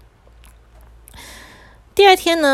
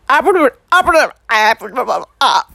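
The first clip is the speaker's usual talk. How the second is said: alien gibberish; 4 dB louder than usual. Acted. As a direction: struggle to speak and babble instead. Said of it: "他说出来就是一串外星话".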